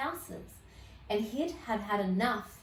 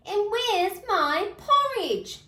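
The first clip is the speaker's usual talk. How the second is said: much higher, slow, whiny small voice; crying Baby Bear; 11 semitones higher, 5 dB louder, slower than usual. Acted. Baby Bear missing his porridge, very high wail. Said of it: "cried Baby Bear".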